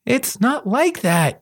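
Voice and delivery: high, slow defensive retort. A narrator's reading. Thief answering his friend's accusations, high drawn-out retort. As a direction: push back, stretching the words high. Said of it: "Kuro retorted".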